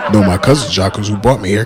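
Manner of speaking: mild campy lilt while denying; talking light. Retold or imitated. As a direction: let it slip out a little light. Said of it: "maybe I talk a little light sometimes".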